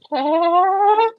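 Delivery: very high, loud, drawn-out call; rising squawky night call; parrot impression. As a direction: swell upward like a creepy bird. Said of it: "like, a little demon voice".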